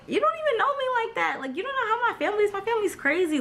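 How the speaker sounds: high, rapid-fire protest; indignant retort. Retold or imitated. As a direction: fire back fast in a high, exasperated pitch. Acted indignant retort to boyfriend.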